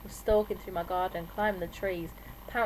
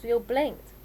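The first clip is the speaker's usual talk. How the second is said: ordinary delivery, nothing marked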